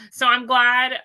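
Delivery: normal talking voice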